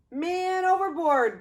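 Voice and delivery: loud, high alarmed call; sailors crying out. Acted. Sailors shouting as George falls overboard, a loud alarmed cry. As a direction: shout the alarm across the deck. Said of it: "the sailors cried".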